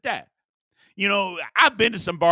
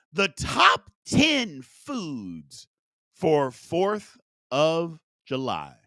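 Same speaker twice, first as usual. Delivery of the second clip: slow, drawn-out game-show title read; drawn-out announcement; much slower than usual. Acted. Host to live viewers, slow dramatic announcement. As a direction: stretch every word like a big reveal.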